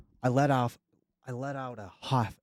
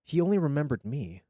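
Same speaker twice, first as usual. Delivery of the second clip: ordinary delivery, nothing marked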